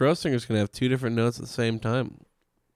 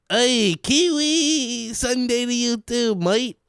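put-on high, slow, hammy New Zealand accent; Kiwi accent; 13 semitones higher, 4 dB louder, much slower than usual. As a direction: drawl it like a mock Kiwi. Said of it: "Michael Johnson's impression of someone".

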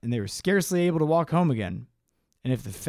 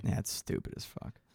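muttered; 9 semitones lower, 12 dB quieter than usual. Real, not acted. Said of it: "You don't get the fucking meta joke?".

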